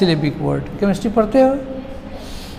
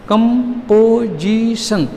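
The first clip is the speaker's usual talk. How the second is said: "Composition" slowly in four separate beats; split into syllables; much slower than usual. Shown as a demonstration. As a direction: count out each syllable of "composition". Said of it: "क्या टुकड़ा होगा".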